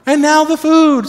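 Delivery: high, loud, slow mock exasperation; exasperated oh boy. Preacher mock-exasperated at Jesus talking about food. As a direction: throw up your hands at Jesus again. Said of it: "me, I'm like".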